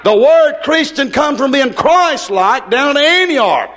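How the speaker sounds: hoarse evangelist at full force, building to a shout; fiery preaching. Acted. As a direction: preach it hard and let it ring out.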